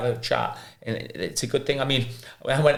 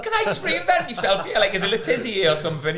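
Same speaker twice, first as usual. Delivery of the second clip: much higher pitch, laughing through the words; 11 semitones higher than usual. Retold or imitated. Telling teammates his number ten dream, laughing. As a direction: giggle it out, high and amused.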